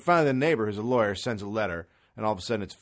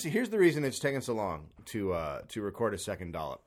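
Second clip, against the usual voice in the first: usual speaking voice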